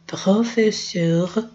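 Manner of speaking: Professeur said slowly with a French uvular R; teacher modelling the French word slowly; slow French model. Shown as a demonstration. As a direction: stretch out Professeur, French R in the throat. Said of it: "veja que o R tá vendo aqui".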